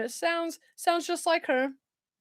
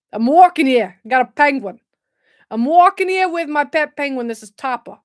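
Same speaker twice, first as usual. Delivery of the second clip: put-on loud, brash New York accent; hammed-up New York accent; 7 dB louder than usual. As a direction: play a New Yorker with an amateur accent. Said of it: "What do people do in New York".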